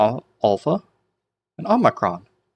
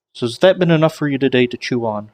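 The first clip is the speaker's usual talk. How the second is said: usual speaking voice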